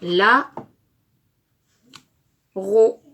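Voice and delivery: "la", long pause, "ro", each loud and distinct; syllable by syllable. Shown as a demonstration. Teacher builds a word for pupils, "la" and "ro" loudly split. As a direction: say "la" and "ro" as separate blocks. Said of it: "Nous fabriquons le mot".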